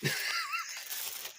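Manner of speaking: high, soft giggle; a quick high-pitched giggle; high laugh. Real, not acted. Teasing his buddy after welcoming him back: high giggle.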